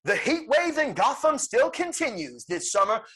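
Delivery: high-pitched mock news reporter. Pitching a film's opening news bulletin, reporter voice. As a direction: project it like a broadcast bulletin. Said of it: "a news report".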